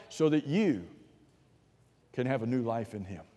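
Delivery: ordinary talk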